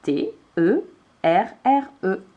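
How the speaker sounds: each letter of TERRE said on its own; spelled letter by letter. Shown as a demonstration. Teacher spelling terre for a child, letter by letter. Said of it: "elle a besoin de terre".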